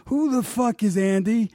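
retold angry outburst; furious rant. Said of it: "he's just furious".

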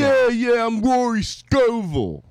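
put-on high, slow Philadelphia accent. Host mimicking a comic in Philadelphia accent. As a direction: stretch it out in a Philly accent. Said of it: "How about that Philadelphia accent?".

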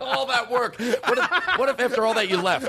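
high-pitched, laughing through the words; real helpless laughter while talking; cracking up. Real, not acted. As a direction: barely get it out for laughing. Cracking up after being trapped.

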